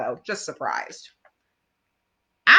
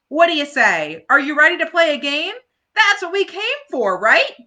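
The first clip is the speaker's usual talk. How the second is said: higher, louder, quick pep talk; rallying call; 9 semitones higher, 8 dB louder than usual. Acted. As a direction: fire up the team. Franklin rallying friends.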